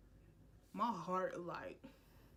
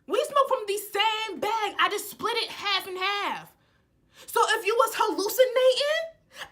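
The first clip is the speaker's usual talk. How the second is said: much higher, louder indignant outburst; ranting at the paranoid friend; heated rant; 7 semitones higher, 9 dB louder than usual. Real, not acted. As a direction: shout the point home.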